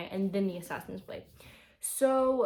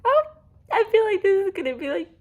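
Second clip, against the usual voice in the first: anxious dread; 11 semitones higher, 10 dB louder, faster than usual. Real, not acted. Dreading the coming scene.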